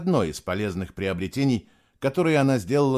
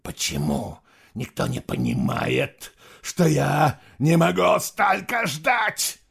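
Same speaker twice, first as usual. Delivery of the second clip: building to a shout; 6 semitones higher, 3 dB louder, slower than usual. Acted. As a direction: build till the last word is yelled. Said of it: "С каждым словом его голос становился громче".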